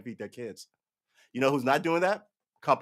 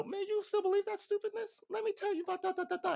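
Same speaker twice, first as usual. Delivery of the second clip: much higher and softer, lightly put-on friend; mocking friend voice; 5 dB quieter than usual. Friend scolds him, in a high, soft put-on voice. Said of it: "you can go".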